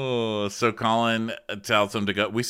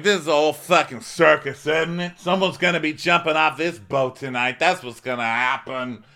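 raised, higher-pitched mock tirade; retold angry rant; 7 semitones higher, 4 dB louder than usual. Drunk guest ranting through the boat, mock angry. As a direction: act out an agitated outburst.

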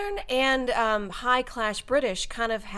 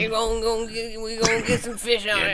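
stumbling mock Cajun; improvised put-on Cajun; Cajun accent. Guest attempts Cajun on request, hammed-up Cajun. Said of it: "Can you do a Cajun?".

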